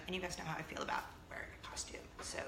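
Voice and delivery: conspiratorial whisper; fast secretive whisper. Real, not acted. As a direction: let them in on a secret. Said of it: "confiding aside".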